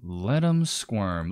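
drawn out slowly, savoring each word; slow savoring echo. Shown as a demonstration. Streamer slowly echoing the line back with relish. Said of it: "Let 'em squirm".

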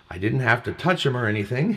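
says "touch" for tutor, plain; misreading touch. Real, not acted. Host misreads tutor as "touch" in list. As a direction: read the word as "touch" not tutor. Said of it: "You said touch".

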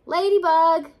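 high, loud call upward. A narrator's reading. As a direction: call the name up into the tree. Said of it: "called Woodchuck".

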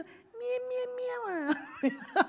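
high, slow, laughing coo; mock sweet talk. Pastor mocks courting a girlfriend, laughing and high. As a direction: coo it like a lovesick suitor. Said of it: "你在追求那个女朋友".

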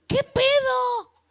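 agudo, lento, gritado de asombro; que pedo estirado y gritado; asombro exagerado. Retold or imitated. (grito de asombro lento y agudo)

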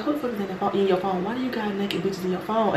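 soft, insistent interrogation; retold hushed scolding; cussing on the low. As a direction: demand answers without being overheard. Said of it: "cussing this motherfucker out on the low".

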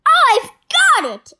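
high, loud burst of inspiration; eureka shout. Acted. Petey hitting on his cloning plan, loud excited shout. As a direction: burst out with the big idea. Villain's sudden idea.

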